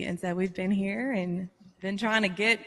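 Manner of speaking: ordinary delivery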